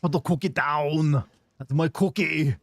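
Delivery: badly done Austrian strongman voice; Arnold voice. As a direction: drag out the cookie line like Arnold Schwarzenegger. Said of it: "prepare my Arnold voice".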